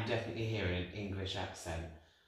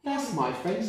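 channelled spirit; 8 semitones higher, 4 dB louder, slower than usual. Retold or imitated. Medium voicing spirit.

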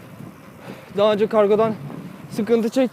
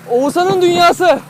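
announcer voice; 9 semitones higher, 7 dB louder, slower than usual. Acted. Announcing his channel name loudly.

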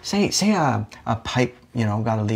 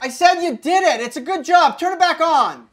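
shouting; 6 semitones higher than usual. Acted. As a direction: yell it down to the basement. Shouting down to the basement.

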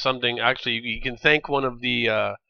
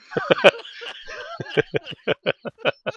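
high, helpless burst of laughter; long laughing fit. Real, not acted. Gamer cracks up at own innuendo, long high laugh. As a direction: laugh hard and let it run.

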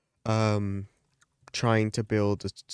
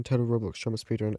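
ordinary talk

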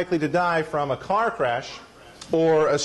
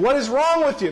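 mock accusation; 8 semitones higher than usual. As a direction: pretend to be outraged, all in jest. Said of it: "No, I'm just kidding".

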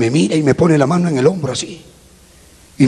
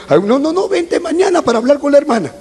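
mildly hammed-up, high insisting pastor; insistencia imitada; 8 semitones higher than usual. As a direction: insist over the objection, pitched up. Pastor retold insisting he return. (insistiendo, en tono más agudo)

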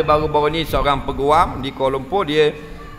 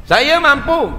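mock proud husband; 11 semitones higher, 6 dB louder, slower than usual. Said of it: "husband claiming he can afford it".

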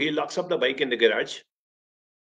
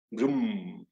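a soft imitated engine vroom, lower and quieter; 4 semitones lower, 5 dB quieter than usual. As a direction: mimic a motorbike revving gently. Bike engine starting imitated.

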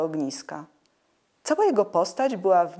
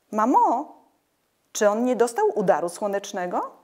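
plain reading voice

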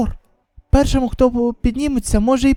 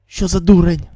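plain reading voice

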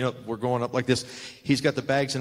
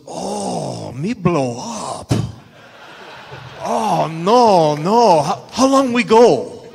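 higher, much louder mock Japanese-accented English with a laugh; broken English impression; 7 semitones higher, 10 dB louder, much slower than usual. Muta protests to Sting about a long match, in loud mock broken English. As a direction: ham up Muta's panicked broken English. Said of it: "he goes".

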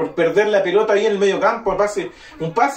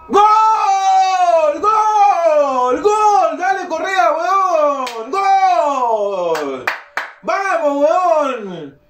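goal celebration; 10 semitones higher, much slower than usual. Real, not acted. Goal celebration while watching match.

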